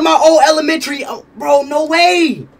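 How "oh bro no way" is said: usual speaking voice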